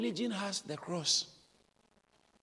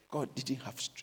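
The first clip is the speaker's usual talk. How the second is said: ordinary talk, nothing marked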